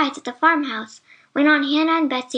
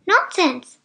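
higher, mildly raised exclamation; brisk narrated cry of disbelief; 5 semitones higher, 4 dB louder than usual. A narrator's reading. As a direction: exclaim it dismissively, pitched up. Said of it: "cried Santa Claus".

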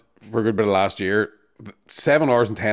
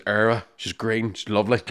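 ordinary talk, nothing marked

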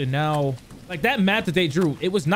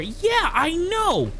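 normal talking voice

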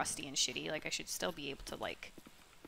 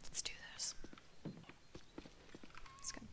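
fast soft whisper; 12 dB quieter, faster than usual. Real, not acted. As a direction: breathe it under your breath. Sneaking, whispering.

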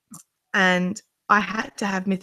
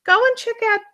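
excited friend; 9 semitones higher, 4 dB louder than usual. Retold or imitated. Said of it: "Emma's come back going".

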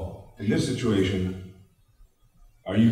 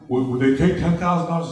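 higher, louder mocking realtor voice; lightly voiced pushy realtor; 7 semitones higher, 4 dB louder than usual. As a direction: ape the clueless realtor. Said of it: "he goes".

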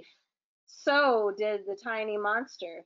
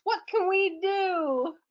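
a higher, slow, worried little princess; Princess in Blankets; 8 semitones higher, slower than usual. Acted. The Princess in Blankets asking the others for help, high and slow. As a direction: ask it slowly, lifting high. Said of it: "said the Princess in Blankets".

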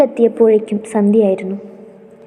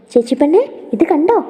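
higher, rising eager question; excited child; 5 semitones higher, faster than usual. Acted. Little girl showing her new dresses to her sister, excited. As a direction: show it off with childlike delight.

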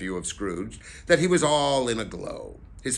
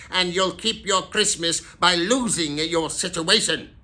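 raised, loud warning of dismissal; angry threat; 7 semitones higher, 5 dB louder than usual. A narrator's reading. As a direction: press the threat home loudly. Scrooge threatens clerk with dismissal.

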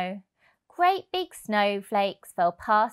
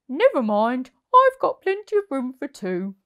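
kindly Percy, a bit louder; reassuring; 4 semitones higher, 7 dB louder than usual. A narrator's reading. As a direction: reassure the little squirrel.

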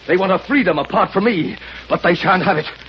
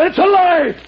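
angry denial; 8 semitones higher, 3 dB louder than usual. Acted. Maxim angrily denies accusation.

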